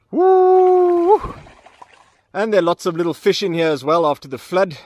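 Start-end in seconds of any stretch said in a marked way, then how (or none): 0.1-1.3 high squeal of delight; squeaky praising a dog. Real, not acted. Cheering dog in water.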